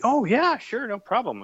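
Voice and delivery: chirpy British accent, imitating the guest happily agreeing. Host recalls Dave Gibbons agreeing to an interview, in a British accent. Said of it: "with that beautiful British accent he has".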